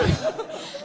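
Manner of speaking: a sudden high, breathless laugh; breathy laugh. Real, not acted. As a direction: burst out laughing at the memory. Teller laughs at own story.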